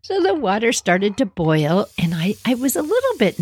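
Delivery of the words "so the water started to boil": usual speaking voice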